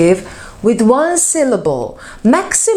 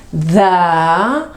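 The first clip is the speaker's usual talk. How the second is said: slow demo; much slower than usual. Shown as a demonstration. Teacher modelling superlative, slow and emphatic. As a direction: lean on the most. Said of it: "What I'm going to do is".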